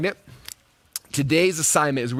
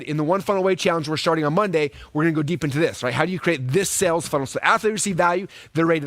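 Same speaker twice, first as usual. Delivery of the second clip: ordinary delivery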